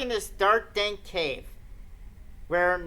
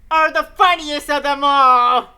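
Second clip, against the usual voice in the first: loud slow mock ghost proclamation; slow loud cartoonish cry; hammed-up shout; 4 semitones higher, 11 dB louder than usual. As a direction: bellow it theatrically. Streamer hams up Sachiko.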